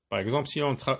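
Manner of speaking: ordinary talk, nothing marked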